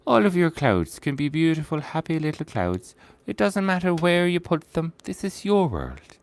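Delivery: a hint of the soothing TV painter; Bob Ross impression. Artist to stream viewers, lightly imitating Bob Ross. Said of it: "what accent that Bob Ross used to have".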